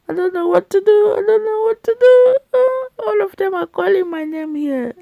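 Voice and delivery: high, loud comic wail; mock overwhelmed. Cook to viewers as all the sauces call her name, mock overwhelmed. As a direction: play-act a frantic, high-pitched dilemma.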